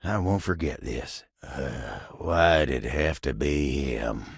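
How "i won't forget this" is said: ordinary delivery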